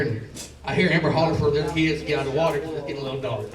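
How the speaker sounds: ordinary talk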